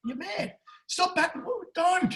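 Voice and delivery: high-pitched mock protest; hammed-up high-pitched exasperation; acted pleading disbelief. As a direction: plead with him, voice jumping high. Acted pleading with a stubborn backer.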